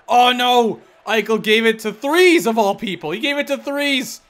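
high, loud panicked play-by-play; real alarmed gamer yelling; alarmed outcry. Real, not acted. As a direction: cry out as the play goes wrong. Dismay at a turnover.

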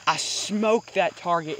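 loud, slow, proud brag; triumphant shout. Real, not acted. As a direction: boast it out loud. Celebrating a hit, shouting.

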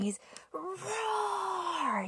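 ordinary delivery

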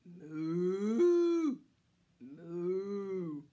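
long, low, drawn-out moos; cow moo. Shown as a demonstration. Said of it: "A cow".

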